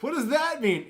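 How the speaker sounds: high, loud mock shock. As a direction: blurt it out in stunned disbelief. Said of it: "And I was like".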